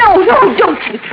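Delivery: high frightened cry; frightened protest. Acted. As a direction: clutch the case and panic.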